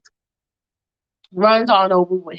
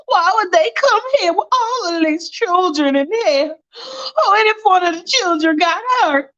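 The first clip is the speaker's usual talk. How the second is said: high-pitched, distraught questioning; upset protest; 9 semitones higher than usual. Acted. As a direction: protest in disbelief, voice lifted high. Upset woman protesting in acted scene.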